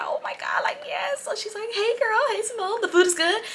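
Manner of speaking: put-on soft, very high girlish squeal; mock happy gushing. Girlfriend greeting her friend at the table, mock happy gushing. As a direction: imitate her delight in a tiny high voice. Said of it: "she's just so happy".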